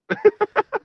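high burst of laughter; quick high laugh. Real, not acted. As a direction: break into a laugh at the punchline. Laughs after punchline.

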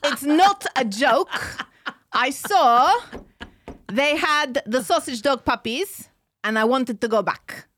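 exaggerated Israeli accent. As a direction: insist emphatically in a put-on Israeli accent. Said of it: "do it in an Israeli accent".

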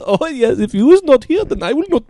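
a sinister German, almost creepy. Acted. Showing how Germans talk, very high, loud creepy German accent. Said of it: "They talk like that".

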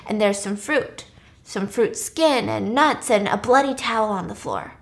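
usual speaking voice

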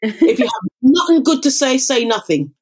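put-on strong Jamaican accent, mother's saying. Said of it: "she says it in the strongest Jamaican accent".

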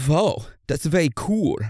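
lightly hammed German accent; mock German accent. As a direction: play an impressed German explorer. Acting German explorer's reaction.

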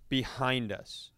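usual speaking voice

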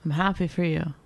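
sarcastic, grudging "happy for you"; dismissive fake congratulations; passive-aggressive. Real, not acted. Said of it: "passive-aggressive person".

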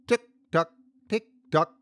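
high tick-tock, like a ticking clock; mouthing the words. A narrator's reading. Hank mocks the victim through the buzzing, high mouthed taunt. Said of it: "Hank mouthing the words".

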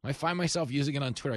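ordinary talk, nothing marked

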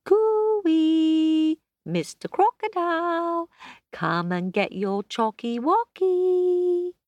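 high, loud sing-song call; calling the croc across the playground. Acted.

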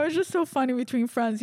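ordinary talk, nothing marked

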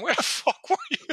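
high-pitched imitated outburst; mock angry. Chief confronting captain who missed meeting, mock angry.

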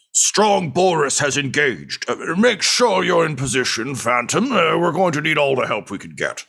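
gruff commander over the radio. Acted. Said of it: "a gruff voice say".

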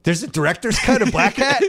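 high, laughing question in disbelief. Real, not acted. To his co-host, laughing disbelief at a director's cut. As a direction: laugh it out, can't believe it.

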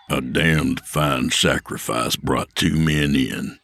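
low, slow, cold correction; cold Will. Acted. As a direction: shut him down flat and slow. Will's grim remark.

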